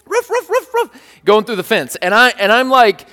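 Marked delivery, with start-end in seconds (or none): 0.1-0.9 high puppy yaps; cute little bark. Retold or imitated. Owner shows his puppy barking through the fence, cute little bark. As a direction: imitate a small dog barking in a high squeak. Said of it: "I know, cute little bark".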